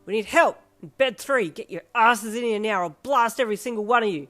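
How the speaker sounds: very loud angry yell; furious shouting. Acted. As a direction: bellow threats at the staff. Shouting threats at staff.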